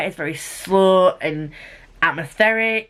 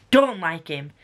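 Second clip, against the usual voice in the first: ordinary talk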